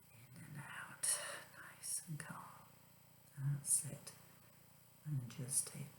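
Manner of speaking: whispered relaxation guide; soft spa whisper. Acted. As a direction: breathe it out softly.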